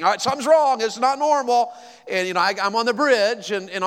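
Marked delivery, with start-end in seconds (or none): 0.0-1.7 higher, louder panicky self-quote; retold panic. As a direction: relive the moment the truck sputters, alarmed. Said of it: "I started panicking".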